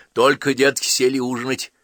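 scolding. A narrator's reading. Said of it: "сварливо набросилась".

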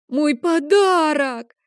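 put-on a child bursting into tears over his gift; crying boy. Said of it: "Лео начал плакать". (плачущий ребёнок, протяжно и громко)

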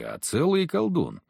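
ordinary delivery

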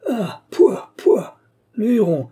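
cri aigu et fort, plein de dégoût; grand cri dégoûté. A narrator's reading. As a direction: crie comme devant une araignée. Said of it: "Richelieu poussa un grand cri, comme s'il apercevait une araignée". (grand cri de dégoût, aigu et fort)

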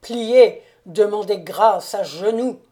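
loud, forceful order, hardest on the first word; thundering command. A narrator's reading. Count orders his wife to kneel, thundering. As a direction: thunder an ultimatum. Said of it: "cria-t-il d'une voix tonnante".

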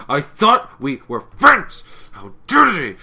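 loud, slow, high furious cry; hammed-up furious pony; furious outburst. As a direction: shout it like a betrayed friend. Furious pony quote retold.

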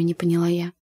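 ordinary delivery, nothing marked

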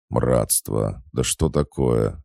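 plain reading voice

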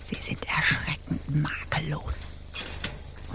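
very slow soft whisper; icy whisper. Acted. The officer confronts the woman, icy whisper. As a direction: drag it out, cold and hushed.

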